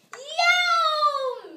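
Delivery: very high, very loud yelp of pain; pained wail. A narrator's reading. Missus McSnoot wailing when bitten, very high and loud. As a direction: cry out as the chicken bites. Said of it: "Missus McSnoot wailed".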